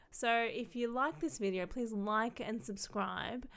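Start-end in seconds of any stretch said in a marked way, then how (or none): none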